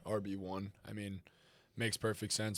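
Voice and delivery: ordinary talk, nothing marked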